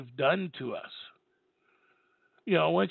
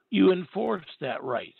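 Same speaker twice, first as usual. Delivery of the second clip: enforce that right, slow and firm; slow emphatic; 2 semitones higher, 4 dB louder, slower than usual. Real, not acted. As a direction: lean on each word.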